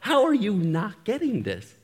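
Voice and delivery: mock the wife yelling in frustration; wife's exasperated yell. Wife yelling at him over his academic writing, exasperated shout. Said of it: "she will actually yell at me".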